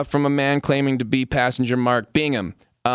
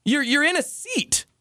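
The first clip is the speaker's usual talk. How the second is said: exasperated; 10 semitones higher than usual. Real, not acted. Exasperated explanation, pitch high.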